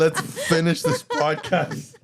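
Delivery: high, loud laughing bail-out; emotional, laughing plea. Real, not acted. Emotional host wanting to end podcast, laughing plea. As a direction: beg to wrap up through laughter. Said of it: "I was getting emotional".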